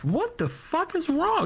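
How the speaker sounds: very high, drawn-out mock fiancee voice; imitated alarm. Fiancee answering his crying call, imitated alarm. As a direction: imitate a worried partner, pitch way up.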